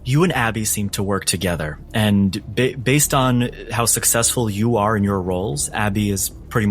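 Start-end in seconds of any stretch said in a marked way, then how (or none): none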